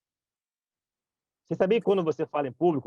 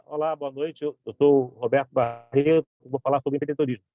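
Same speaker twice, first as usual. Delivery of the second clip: unengaging opening. Shown as a demonstration. Speaker showing a weak opening to an audience, subdued and monotone. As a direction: open a talk without inflection or energy. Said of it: "Imagina eu chegar".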